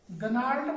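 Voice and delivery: गनार्ड with the G sounded, "ga-naard"; mistaken pronunciation. Shown as a demonstration. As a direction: read गनार्ड as spelt, voicing the G. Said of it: "इसको क्या पढ़ना चाहिए?".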